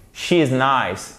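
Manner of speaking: she is nice slow, long I in she; correct English she is nice. Shown as a demonstration. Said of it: "pas she is nice". (she is nice, I long et lent)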